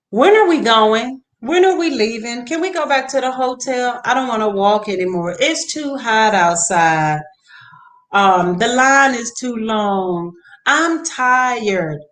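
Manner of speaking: put-on high, loud whining child; mock kid complaining at the theme park; imitating whiny kids. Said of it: "all kinds of stuff started coming up".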